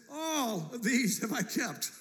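high, slow mock self-satisfaction; haughty. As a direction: boast grandly, drawing it out high. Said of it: "the dude's kinda haughty".

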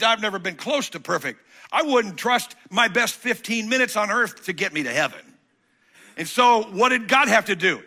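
loud, climbing pulpit preaching; fiery preaching. Acted. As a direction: preach it out full force to the congregation. Preaching builds to full force.

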